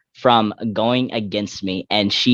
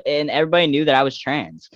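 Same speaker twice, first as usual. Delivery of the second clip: usual speaking voice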